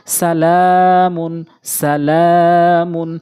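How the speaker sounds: সালামুন with short সা, long held লা, quick মুন; slow recited সালামুন, middle syllable drawn out; one-alif elongation on লা. Shown as a demonstration. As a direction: stretch only the লা in সালামুন, level and full. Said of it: "লাম এক আলিফ টানতে হবে".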